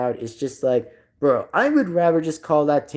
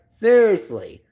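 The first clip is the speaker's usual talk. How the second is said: ordinary talk, nothing marked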